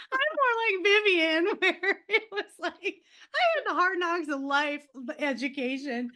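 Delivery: words squeezed out through a high laugh; laughing while talking. Real, not acted. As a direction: keep laughing as you say it. Laughing through self-deprecating line.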